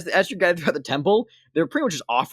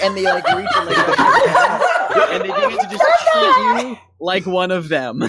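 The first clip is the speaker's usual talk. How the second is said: high, narrating through real laughter; cracking up; 5 dB louder than usual. Real, not acted. Game master narrating devotees' change of heart, helpless laughter. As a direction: struggle to speak while laughing hard.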